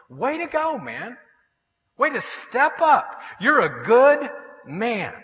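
high-pitched, drawn-out praise; admiring cheer. Retold or imitated. Preacher applauding his friend's choice, admiring cheer. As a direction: cheer him on, stretching the key words.

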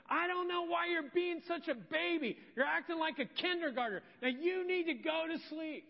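exasperated dad yelling, high and loud. Retold or imitated. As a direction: re-enact a father losing patience with his child. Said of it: "raised my voice and yelled at you".

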